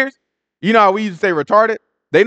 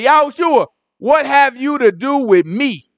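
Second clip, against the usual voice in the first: high, slow demon pleading; possessed man crying out to Yahushua, high and slow; evil spirit voice; 8 semitones higher than usual. Acted. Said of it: "the evil spirit talking".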